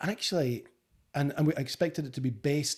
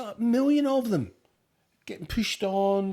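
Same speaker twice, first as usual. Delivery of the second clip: Scottish voice; 6 semitones higher, 4 dB louder, slower than usual. Pretend. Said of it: "in your Scottish voice".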